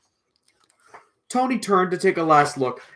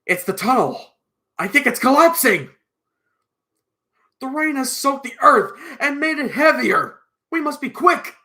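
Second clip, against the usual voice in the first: raised, high-pitched warning; urgent alarm; 10 semitones higher, 6 dB louder than usual. Acted. Mister Bold warning the tunnel is collapsing, urgent alarm. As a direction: warn them loudly, pitch climbing with urgency.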